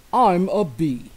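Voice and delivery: loud, slow buzzing bee. Acted. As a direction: boom it out like a big bee. Bee character speaks.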